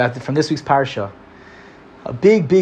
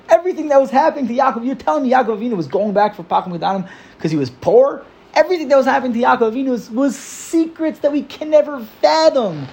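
high, fast, pushy objection; indignant protest; 10 semitones higher than usual. Retold or imitated. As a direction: argue back that it was all holy secrets. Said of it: "So what does Yid say?".

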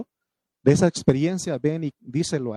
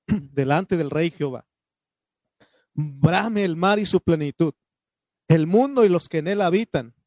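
fervent scripture reading; 3 semitones higher than usual. Acted. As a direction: read it like a preacher lifting the verse. Reads the psalm aloud.

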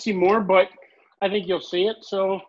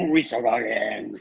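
slow, low drawn-out catchphrase impression; Rob Schneider's Waterboy character; 9 semitones lower, slower than usual. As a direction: drag it out in a lower put-on voice. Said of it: "Rob Schneider's character from Waterboy".

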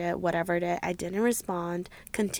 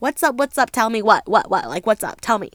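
loud, rapid-fire frantic questions; acted panic; 9 dB louder than usual. Begging him on the phone to tell her, panicked. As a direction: fire it out in a panic, demanding an answer. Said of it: "I'm still panicking".

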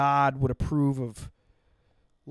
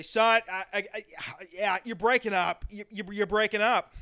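raised, stammering mock father; stern dad speaking up; 4 semitones higher than usual. Dad telling son he's breaking up, sternly stammered. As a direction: imitate a dad insisting through restrained irritation. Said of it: "he'd speak louder".

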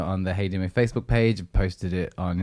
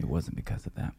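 muttered repeat; 4 semitones lower, 10 dB quieter than usual. Real, not acted.

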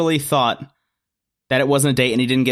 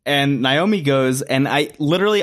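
ordinary talk, nothing marked

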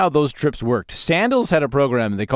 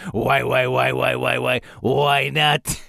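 drawn-out teasing bartender chant, slow at the end; bartender catchphrase; slower than usual. Retold or imitated. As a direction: egg them on like a bartender pouring shots. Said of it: "He has this catchphrase he does".

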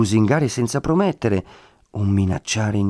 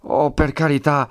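higher, politely impatient exclamation; grateful impatience; 6 semitones higher than usual. A narrator's reading. Woman declining another friar's help, mildly impatient gratitude. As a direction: brush off a kind offer with mild impatience. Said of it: "misto di gratitudine e di impazienza".

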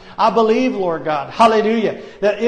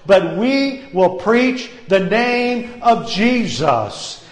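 usual speaking voice

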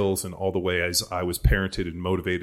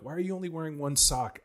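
higher incredulous question; exasperated dad; 9 semitones higher, 3 dB quieter than usual. Retold or imitated. Dad asking his daughter about one sock, higher and incredulous. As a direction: pitch it up in disbelief.

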